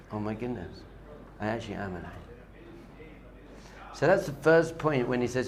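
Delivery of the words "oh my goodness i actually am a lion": low, soft dawning wonder; hushed realisation. Acted. Lion cub realising his true self, hushed. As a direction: let it sink in quietly.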